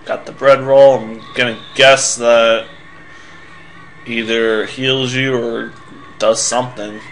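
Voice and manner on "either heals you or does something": ordinary delivery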